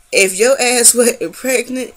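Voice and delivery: voicing mom's scolding with heat; mom's threat. Mom warns her cranky pregnant daughter, mock scolding. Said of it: "She said".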